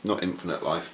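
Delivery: normal talking voice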